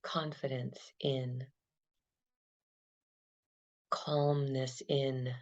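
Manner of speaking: calm, unhurried meditation guide; guided meditation. Acted. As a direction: lead them gently through the breath. Guided body-scan meditation.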